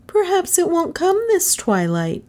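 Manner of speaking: put-on high-pitched child's suggestion; hopeful little girl. A narrator's reading. Girl timidly suggests to policeman.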